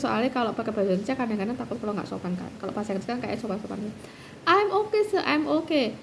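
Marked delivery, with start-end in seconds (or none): 4.4-5.9 polite English reply. Retold or imitated. Telling promotor two she is fine, lightly voiced polite English. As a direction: play it small and polite to the professor. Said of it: "sukanya ngomong pakai bahasa Inggris".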